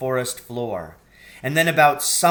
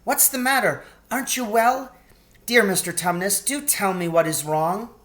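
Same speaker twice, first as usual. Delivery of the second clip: distressed pleading; 8 semitones higher, 4 dB louder than usual. A narrator's reading. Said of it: "in great distress".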